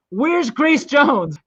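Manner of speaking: retold drunken call. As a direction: imitate suddenly calling across a room. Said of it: "he stopped and suddenly asked".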